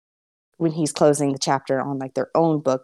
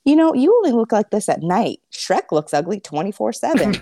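higher-pitched character voice, starting louder; Donkey; 7 semitones higher, 4 dB louder than usual. Acted. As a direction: reassure her in an animated, pitched-up voice. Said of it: "Donkey looks at her ... and he goes".

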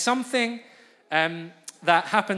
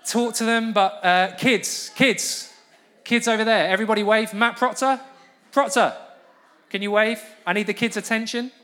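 calling out; 6 semitones higher, 7 dB louder than usual. Real, not acted. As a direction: call out loud and high to get attention. Calling out to kids across room.

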